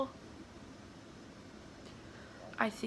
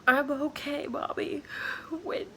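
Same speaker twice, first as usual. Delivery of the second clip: higher voice, halting through real tears; crying; 5 semitones higher than usual. Real, not acted. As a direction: talk through real crying, pausing to get the words out. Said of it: "I'm sorry for crying".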